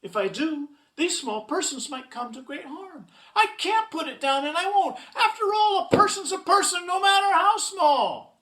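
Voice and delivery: worried, building to high, loud insistence; hammed-up storybook conviction, rising; alarm turning resolute. A narrator's reading. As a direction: grow from alarm into a loud declaration. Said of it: "Horton thought with alarm".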